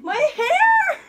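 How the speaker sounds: very high, loud shriek. Real, not acted. Holding her cut-off hair, a very high loud shriek. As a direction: shriek it out in shock.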